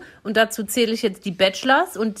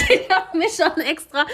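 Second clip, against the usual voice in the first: laughing while speaking; 7 semitones higher than usual. Real, not acted. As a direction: giggle through the words. Laughing about her T-shirt.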